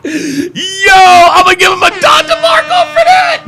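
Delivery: high squealing laugh-shout. Real, not acted. Laughing fit after the clip.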